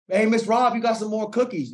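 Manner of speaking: retold yell. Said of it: "I mean, he yells at her".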